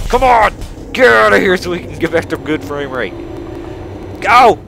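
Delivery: loud, high impatient yelling; exasperated shouting. Real, not acted. Player urging lagging game on, exasperated shout. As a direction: yell to hurry it along.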